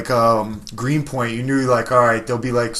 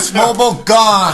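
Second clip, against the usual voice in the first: loud, high mock-rage shout; hammed-up shouted threat; mock threatening rant; 9 semitones higher, 9 dB louder than usual. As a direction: yell it like a comic ultimatum. Acting out a threat to customers.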